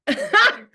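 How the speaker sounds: sudden high loud laugh; bright startled laugh; burst of laughter. Real, not acted. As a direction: laugh out at the glitch. Laughing at Zoom glitch.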